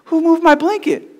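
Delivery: high-pitched imitation of an upset cousin. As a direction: squeal it in mock outrage, loud and high. Upset cousin's quote acted out.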